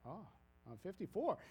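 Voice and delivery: ordinary talk, nothing marked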